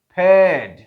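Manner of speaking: फेड said loudly as peɖ, no R; non-rhotic paired. Shown as a demonstration. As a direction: say फेड with no R at the end. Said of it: "पेड कहते हुए फिर आप आर को नहीं पढ़ते".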